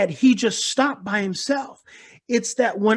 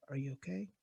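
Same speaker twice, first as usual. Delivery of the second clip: soft, low, tender question; very gently; 7 semitones lower, 18 dB quieter, faster than usual. Retold or imitated. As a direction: ask softly like to a scared child. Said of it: "Very gently".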